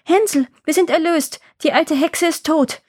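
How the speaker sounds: high, jubilant cry of release; joyful calling out. A narrator's reading. Gretel freeing Hänsel, jubilant call. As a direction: announce the good news in a high, elated voice. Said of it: "öffnete sein Ställchen und rief".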